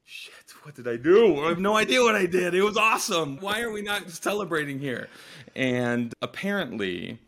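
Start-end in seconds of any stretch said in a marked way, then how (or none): 0.0-5.1 high, loud, baffled self-quote; bewildered. Retold or imitated. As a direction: caught off guard, protesting confusion. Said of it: "And I'm like".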